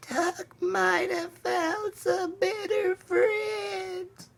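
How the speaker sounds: very high, soft, slow crying voice; sad little voice. Acted. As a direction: let the words break in tears, tiny and high. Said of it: "whispered in a sad little voice".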